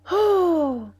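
high, drawn-out exclamation; long falling cry of rapture; delighted wonder. A narrator's reading. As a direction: sigh out an admiring, high cry. Julie delighted by the view.

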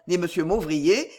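ordinary delivery, nothing marked

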